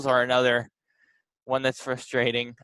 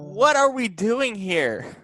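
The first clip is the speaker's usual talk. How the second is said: higher, drawn-out exasperated question; exasperated rant; 7 semitones higher, slower than usual. Real, not acted. Podcaster ranting about the Knicks, exasperated. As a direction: stretch it out in disbelief and frustration. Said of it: "do your Taylor Twellman impersonation".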